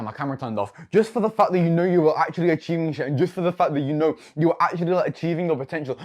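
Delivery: normal talking voice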